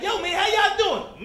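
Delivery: high, drawn-out friendly call; hammed-up upbeat hello; mock cheery greeting. Comic greeting his newly married friend, mock cheery call.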